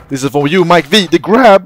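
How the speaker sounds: rising, loud hyped-up call; excited dedication. Real, not acted. Player dedicating a trick to Mike V, excited shout. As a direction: build to a high shout.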